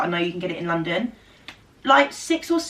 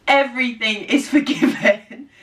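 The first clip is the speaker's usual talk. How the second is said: laughing relief; 3 semitones higher, 4 dB louder than usual. Retold or imitated. Relieved when driver arrives.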